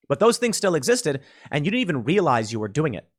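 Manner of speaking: ordinary delivery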